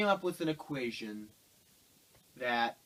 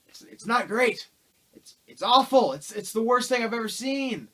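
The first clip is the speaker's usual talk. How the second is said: loud, high outraged rant; yelling it's awful, it's the worst; 8 semitones higher, 8 dB louder than usual. Real, not acted. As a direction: burst out in disgust at the film. Outraged verdict on the film.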